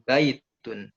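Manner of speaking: BAI-tun, first syllable hit firmly; stress on first syllable. Shown as a demonstration. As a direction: punch the "bai" in baitun, let "tun" drop. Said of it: "Ba-nya ditekan".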